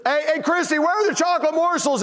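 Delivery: high, pointed holler; loud enough to overhear. Retold or imitated. Dad asking his wife so the daughters overhear, hollering. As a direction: call out so the whole house hears. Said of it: "saying it loud enough so my daughters can hear".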